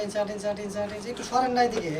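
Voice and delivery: normal talking voice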